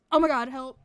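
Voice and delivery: loud. Real, not acted. Reacts to her own mistake.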